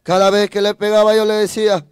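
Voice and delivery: ordinary talk, nothing marked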